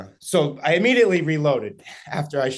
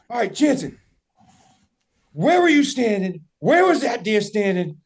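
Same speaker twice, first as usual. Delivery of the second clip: high, louder imitation of an excited friend; raised-pitch, pressing retold demand; urgent questioning; 8 semitones higher, 5 dB louder than usual. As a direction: call out each question firmly.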